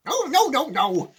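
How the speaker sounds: loud, high refusal; yelling back. A narrator's reading. Wonka refusing the panicking grandparents, yelled. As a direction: shout them down. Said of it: "Mister Wonka yelled back".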